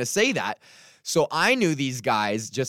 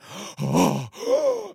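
shocked audience gasp. Retold or imitated. Comic imitating an offended audience, shocked gasp. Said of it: "No reaction is better than".

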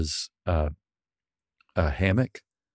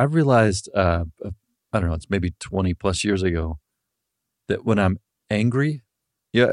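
normal talking voice

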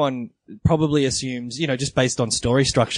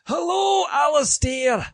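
much higher, shouted Scottish "Hello, Alistair" through a laugh; mock Scottish accent; slower than usual. Co-host joins in greeting the Scottish emailer with a hammed-up Scottish accent. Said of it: "No, you cannot".

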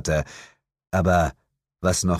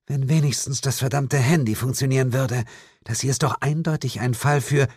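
plain reading voice

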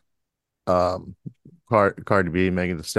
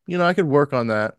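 ordinary delivery

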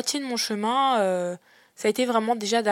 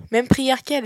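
echoing it in disbelief; mocking, higher repeat; incredulous; 4 semitones higher, 4 dB louder than usual.

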